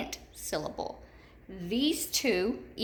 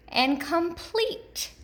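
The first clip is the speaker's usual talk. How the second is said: "complete" slow and high, long E on "plete"; stressed second syllable; 8 semitones higher, slower than usual. Shown as a demonstration. Teacher giving an example, "complete" slowly stressed on its second syllable. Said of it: "hear those long E's in both of those".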